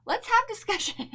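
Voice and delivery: excited invitation. Real, not acted. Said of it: "if you don't agree with our opinions".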